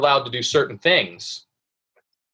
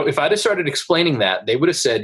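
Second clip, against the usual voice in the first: ordinary delivery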